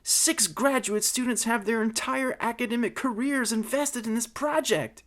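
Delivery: high-pitched, earnest appeal; pleading. Acted. Mary Ann begging for the students' careers, pleading high. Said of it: "pleaded my sweetheart".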